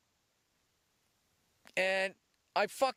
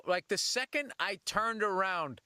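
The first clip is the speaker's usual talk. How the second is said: ordinary talk, nothing marked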